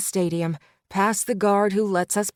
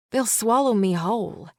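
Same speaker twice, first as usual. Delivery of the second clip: ordinary delivery, nothing marked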